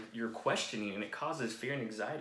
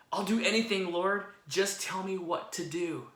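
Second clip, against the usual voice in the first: eager plea; 6 semitones higher, 3 dB louder than usual. Acted. Jack begging for instructions.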